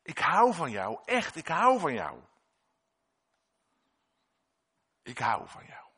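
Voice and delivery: loving declaration. Acted. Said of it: "En dan zegt Jezus".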